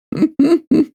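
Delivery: short high-pitched giggle; giggling. Real, not acted. Streamer amused by their own awards ceremony, giggling. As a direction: giggle at your own silly bit.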